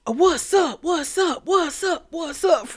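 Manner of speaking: high, repeated wrestler catchphrase; R-Truth catchphrase impression. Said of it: "He looked like R-Truth".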